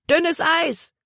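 high-pitched called-out warning; urgent warning call. A narrator's reading. Emma warns Libby about the ice, urgent high call. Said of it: "rief Emma ihr zu".

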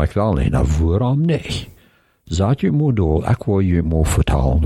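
usual speaking voice

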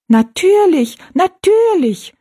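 very high, loud little voices; squeaky dwarf echo. A narrator's reading. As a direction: chirp it as tiny folk. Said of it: "wiederholten die drei Zwergenmenschen".

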